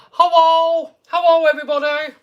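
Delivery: imitated odd teacher's accent, high and loud; loud, high-pitched mock accent of a teacher greeting everyone; Mister Neild. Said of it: "I don't know what accent he had".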